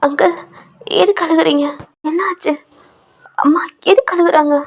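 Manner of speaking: put-on small boy's high, anxious questions; high pleading child voice; child asking. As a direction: ask like a worried little kid. Little boy asks why crying.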